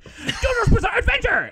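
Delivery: very high, loud bursting-in announcement; Kramer-style shout. Retold or imitated. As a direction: burst through the door yelling the title. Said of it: "slam down the door Kramer style and be like".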